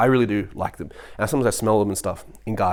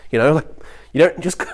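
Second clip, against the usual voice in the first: ordinary delivery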